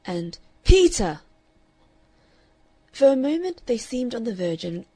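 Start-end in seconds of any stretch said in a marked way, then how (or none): 0.6-1.2 loud, high warning call; warning cry. A narrator's reading. Aunt warning her brother mid-struggle, loud cry. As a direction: cry out to stop him. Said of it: "cried Miss Stanley warningly".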